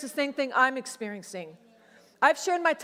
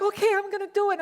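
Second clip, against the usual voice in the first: nervous excitement; 8 semitones higher, faster than usual. Retold or imitated. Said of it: "I'm like".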